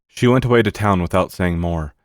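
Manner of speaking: plain reading voice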